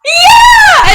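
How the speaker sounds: high, loud, drawn-out cheer; excited shout. Acted. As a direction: yell it with delighted laughter in it. Player acts joyful reunion with friend.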